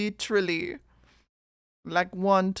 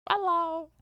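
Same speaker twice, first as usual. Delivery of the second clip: high-pitched hello; 12 semitones higher than usual. Pretend. Echoing greeting in high voice.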